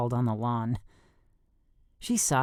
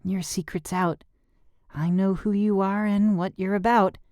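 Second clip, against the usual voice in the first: put-on girl voice; 7 semitones higher than usual. A narrator's reading. Girl's voice in story.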